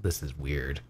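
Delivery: low, soft puzzled mutter; weirded out. Retold or imitated. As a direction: sound baffled. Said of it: "levels of".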